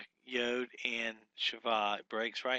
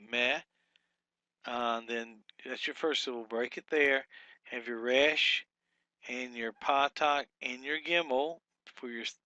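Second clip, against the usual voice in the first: usual speaking voice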